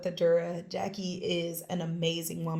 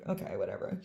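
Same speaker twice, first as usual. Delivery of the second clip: dismissive; 10 semitones lower, 7 dB quieter, faster than usual. Retold or imitated. Unimpressed reply to mystery client.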